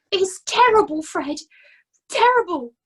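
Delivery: wailing about his ruin; distraught Nobby. A narrator's reading.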